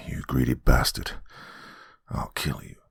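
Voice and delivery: low, dropping to a breathy threat; threaten, sinking almost to a whisper; low menace. Acted. Betrayed man threatening his friend, menacing.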